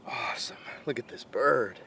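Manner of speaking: breathy whispered awe. Real, not acted. Hunter admiring his bird, whispering in awe. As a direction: hush it like you're afraid to spook the woods.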